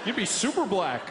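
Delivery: slow, drawn-out American "hell yeah", laughing along; American accent. Retold or imitated. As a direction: lay on a chummy American twang. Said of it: "He was like".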